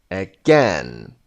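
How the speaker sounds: again with GAIN hit and falling; stress on second syllable. Shown as a demonstration. Teacher repeating the word for learners, second-syllable stress on again. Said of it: "second syllable".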